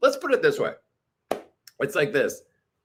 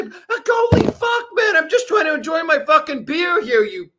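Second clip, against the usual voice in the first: fed-up outburst; exasperated rant; 12 semitones higher, 5 dB louder than usual. Real, not acted. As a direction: vent at the babblers. Ranting at babblers.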